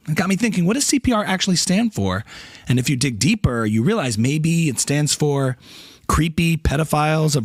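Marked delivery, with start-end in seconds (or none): none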